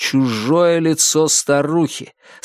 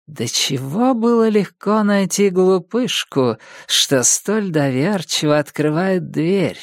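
higher mocking hag voice; witch taunting; 6 semitones higher than usual. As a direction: sneer at the foolish girl. Witch taunts her victim.

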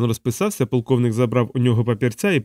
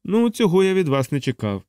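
high-pitched reproach; disappointed major, voice pitched up; reproachful; 6 semitones higher than usual. Acted. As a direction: chide in a raised pitch. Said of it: "з докором у голосі вирік майор".

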